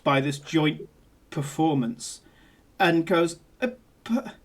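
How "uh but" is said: faint, halting, with long pauses; speechless, barely getting a word out; stunned stammer. Acted. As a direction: falter softly, dumbfounded. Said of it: "he's completely stunned".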